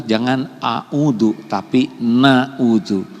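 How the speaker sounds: slow contrast of "a'udzu" and "na'udzu"; stressing na'udzu. Shown as a demonstration. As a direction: draw out each word. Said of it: "Kalau mau a'udzubillah". (tekan kata "na'udzu" pelan-pelan)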